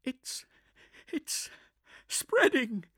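high, breathless panic; rising panic. Acted. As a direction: voice climbing in fear. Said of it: "panic rising in her voice".

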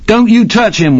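high, loud frightened cry; cried out. A narrator's reading. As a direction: yell to protect a friend. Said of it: "one of his friends cried".

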